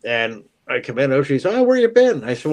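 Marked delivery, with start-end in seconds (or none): 1.5-2.2 high loud voice. Retold or imitated. Father voiced in story.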